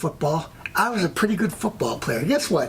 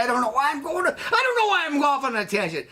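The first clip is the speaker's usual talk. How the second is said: high-pitched exasperation at rambling; worked-up self-reproach; exasperated with himself; 8 semitones higher than usual. Real, not acted. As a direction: throw it out high, fed up with your own tangent. Exasperated at own digression.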